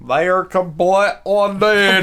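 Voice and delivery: loud, hammed-up Scottish accent; mock Scottish accent. Joking about naked children, loud mock Scottish accent. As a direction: bellow it in a bad Scottish brogue. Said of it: "what's that accent?".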